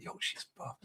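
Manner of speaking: barely audible whispered admiration; awed whisper. Retold or imitated. Friend in the club admires a woman, awed whisper. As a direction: imitate a friend whispering his approval.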